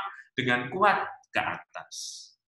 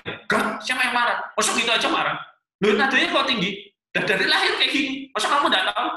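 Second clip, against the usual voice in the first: loud, high, indignant outburst; hammed-up anger while denying it; angry denial; 10 semitones higher, 6 dB louder, faster than usual. Spouse denying being angry to partner, heated. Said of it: "Kalau marah juga bilang marah ya, jangan bilang gini".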